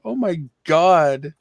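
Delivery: worn out from laughing; after laughing hard. Real, not acted. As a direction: still winded from a laughing fit. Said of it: "My cheeks are, like, sore".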